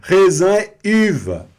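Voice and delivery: "uva" said loudly as "iva", French-style; high, amused, turn "uva" into "iva"; joking misreading. Shown as a demonstration. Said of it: "Quase que eu li isso aqui como uva". ("uva" errado de brincadeira, como "iva")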